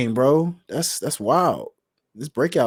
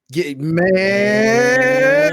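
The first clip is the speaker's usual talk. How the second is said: loud, drawn-out cry of approval; hyped exclamation; 8 semitones higher, 6 dB louder than usual. Real, not acted. Podcaster relishing his favorite highlight, hyped. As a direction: stretch it out, rising high and loud.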